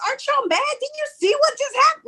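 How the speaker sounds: high, fast, incredulous outcry; exasperated on air. Retold or imitated. Host on air to listeners, high fast disbelief. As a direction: plead with the audience in disbelief. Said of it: "I'm like on the air".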